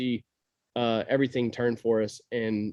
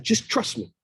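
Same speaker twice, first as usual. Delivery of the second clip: confident self-quote; 9 semitones higher than usual. Retold or imitated. Retold reply to wife.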